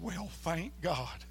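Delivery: slow, soft, choked-up thanks; tearful relief. Retold or imitated. Grateful man at the door, tearful relief. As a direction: let relief break through tears. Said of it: "he busted out crying".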